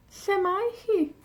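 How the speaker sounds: high, bright-pitched Lle mae hi; high model question. Shown as a demonstration. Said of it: "repeat higher".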